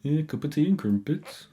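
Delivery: lightly put-on posh English accent; posh British accent. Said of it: "What was that accent?".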